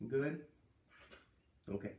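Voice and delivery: plain reading voice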